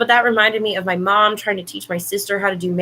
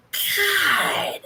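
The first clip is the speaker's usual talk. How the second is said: high exasperated god; fed up with the dog; long-suffering sigh of god; exasperated groan; 7 semitones higher, 4 dB quieter than usual. Real, not acted.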